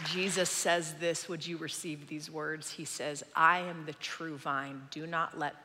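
low, soft scripture voice; hushed preaching. Real, not acted. Preacher asking church to receive Jesus' words, softly.

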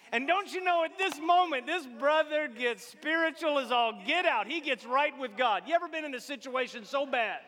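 high, loud revival preaching; fired-up sermon peak; full-force preaching. Acted. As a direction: preach it out to the back pews.

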